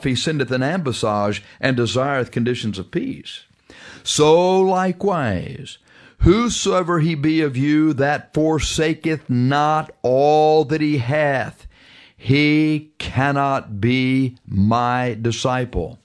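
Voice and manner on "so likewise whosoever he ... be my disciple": weighty, word-by-word scripture reading; slow right down to drive the verse home; measured preacher's pronouncement, every word held; slow solemn emphasis. Real, not acted.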